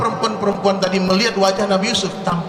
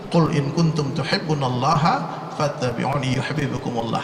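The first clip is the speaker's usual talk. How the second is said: slow, chanted Arabic recitation; Quran recitation; 4 semitones lower than usual. Acted. Preacher recites the Quran verse to the congregation, slow and chanted. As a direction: intone the verse slowly and reverently.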